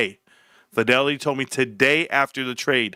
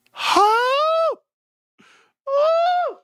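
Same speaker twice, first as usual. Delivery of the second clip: excited whoop; 3 dB louder than usual. Real, not acted. Warrant valuation news arrives.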